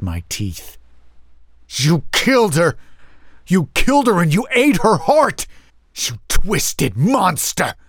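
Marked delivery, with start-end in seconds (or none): 1.7-7.7 high, anguished raging outburst; furious accusation. Acted. Younger brother accusing the mother's killer, enraged. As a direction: hurl the accusation at full pitch.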